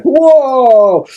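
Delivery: high, loud burst of wonder; amazed whoa. Retold or imitated. Leaving Star Wars, reliving his amazement with a loud whoa. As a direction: blown away by the movie, a big high whoa. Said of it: "and I was like".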